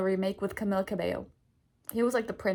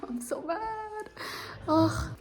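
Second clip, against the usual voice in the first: mad; 8 semitones higher, much slower than usual. Real, not acted. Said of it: "I'm so mad right now".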